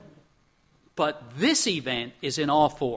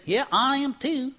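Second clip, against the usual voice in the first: put-on Texan southern accent; 7 semitones higher than usual. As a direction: mimic a friend's southern accent, higher. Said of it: "He had that southern accent".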